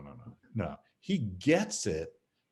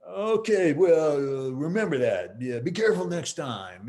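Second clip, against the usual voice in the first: high, loud gruff backing down; mock tough guy; 5 semitones higher, 5 dB louder than usual. Bully backing down after the apology, mock high loud grumble. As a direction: play the bully letting it go. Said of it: "the guy wondering".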